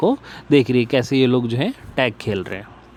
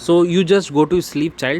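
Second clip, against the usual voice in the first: slow read; 4 semitones higher, much slower than usual. Shown as a demonstration. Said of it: "said Alice".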